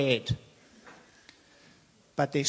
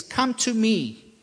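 higher, slow, ringing plea; urgent call; 8 semitones higher, slower than usual. Acted. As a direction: lift it high and stretch it out. Preacher voicing Jesus' call.

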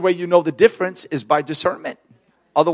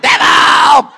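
high, hammed-up exorcist yell; mock deliverance holler; old-time Pentecostal shout; 10 semitones higher, 10 dB louder than usual. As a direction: bellow it like a revival preacher. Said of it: "You know the old time Pentecostals".

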